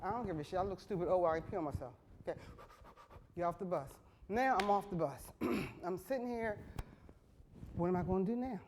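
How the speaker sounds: ordinary talk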